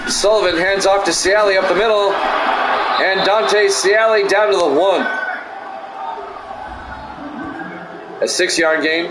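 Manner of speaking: steady sportscaster call of each play. Acted. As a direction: call the drive like a broadcaster. Play-by-play of game.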